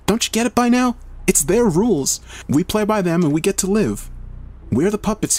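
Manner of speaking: high-pitched, heated mock scream. A narrator's reading. Heather rages at Melissa over the rules, screaming. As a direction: scream it high without full volume. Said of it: "Heather screams".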